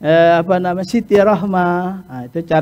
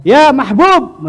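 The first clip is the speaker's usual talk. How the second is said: high, drawn-out, louder call; 8 semitones higher, 4 dB louder, much slower than usual. Shown as a demonstration. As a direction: call out the name slowly across the room. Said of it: "manggil itu dengan biasa".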